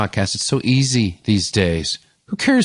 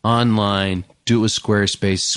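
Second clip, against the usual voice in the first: usual speaking voice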